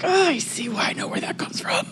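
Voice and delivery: soft laugh, then a fast whisper; whispered aside. Real, not acted. Laughing aside after the outburst.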